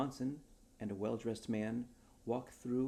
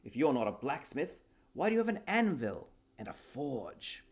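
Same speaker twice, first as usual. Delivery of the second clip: huffy duke; 5 semitones higher, 7 dB louder than usual. Acted. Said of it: "huffed the Duke".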